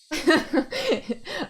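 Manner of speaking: high, helpless giggle; laughter. Real, not acted. Reader entertained by a pronunciation, real giggling. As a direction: giggle high up, genuinely tickled.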